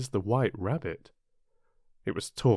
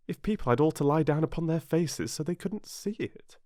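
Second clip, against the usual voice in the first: put-on higher-pitched girl puzzling it out; Alice's musing; 7 semitones higher than usual. A narrator's reading. Alice reasoning to herself about processions, higher musing voice. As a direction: wonder to yourself, pitched up.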